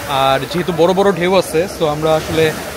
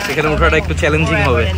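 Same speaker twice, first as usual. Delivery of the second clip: usual speaking voice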